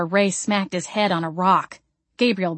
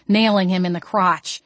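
plain reading voice

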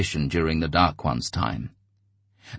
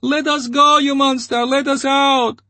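much higher, loud pleading squeal; woman squealing at the monster above; desperate squeal; 8 dB louder than usual. A narrator's reading. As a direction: scream it over the crowd. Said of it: "a woman's voice squealed".

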